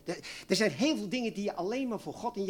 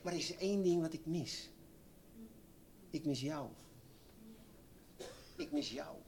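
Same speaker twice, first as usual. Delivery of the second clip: soft, fading words of the Lord; the Lord softly longing; 10 dB quieter than usual. Acted. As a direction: let it fall away gently, longing. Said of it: "En de Heer zegt". (zacht en verlangend, wegstervend)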